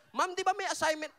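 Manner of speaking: put-on the keen kid calling out to the teacher, high and loud; eager student. Preacher acting an eager student reminding the teacher, high and loud.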